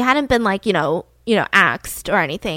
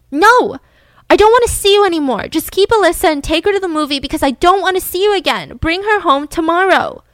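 higher, louder acted outburst; upset refusal; 7 semitones higher, 5 dB louder than usual. Betty refusing to see Candy anymore, upset. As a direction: shut her out, loudly and insistently. Acted quarrel, wife rejects rival.

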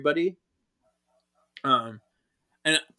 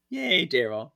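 usual speaking voice

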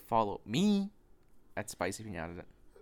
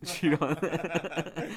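slow mocking echo; 3 semitones higher, much slower than usual.

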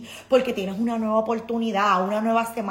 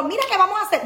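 high, louder eager visitor voice; pushy visitor; 11 semitones higher, 6 dB louder, faster than usual. Retold or imitated. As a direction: nag brightly like a guest wanting plans. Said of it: "vienen esto y lo otro".

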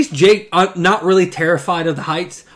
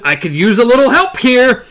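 raised voice calling for help; loud call; 8 semitones higher, 7 dB louder than usual. A narrator's reading. Jake calling loudly to Roland for help. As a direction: shout it up for help. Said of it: "he bellowed".

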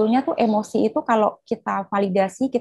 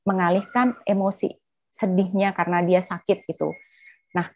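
ordinary talk

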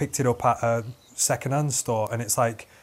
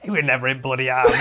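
quick, louder mock-scoffing punchline; blunt grandma retort; 5 dB louder, faster than usual. Grandma debunking her Robert's army story, quick and blunt. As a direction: fire it out fast, on the edge of laughter.

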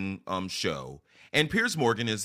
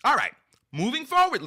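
excited; 14 semitones higher, 6 dB louder than usual. Real, not acted. Energetic transition.

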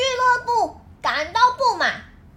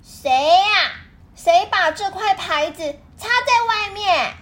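loud, angry demand; 7 semitones higher, 5 dB louder than usual. A narrator's reading. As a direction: bark out who did it. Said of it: "生气地走进俱乐部". (生气地大声质问)

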